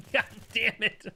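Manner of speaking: high squeaky laugh through the words; giggling curse. Real, not acted. Laughing at being stuck.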